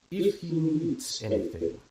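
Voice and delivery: unbothered and slow; unintimidated drawl. A narrator's reading. Scientist answering the lieutenant, unintimidated and slow. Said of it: "taking his time, refusing to be intimidated".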